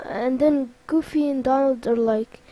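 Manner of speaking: plain reading voice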